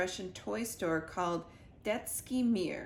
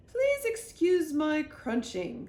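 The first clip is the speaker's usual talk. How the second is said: higher, louder, slow queenly voice; 8 semitones higher, 6 dB louder, slower than usual. A narrator's reading. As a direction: apologise grandly, mouth full of biscuit. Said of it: "said the Queen".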